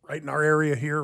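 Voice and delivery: ordinary talk, nothing marked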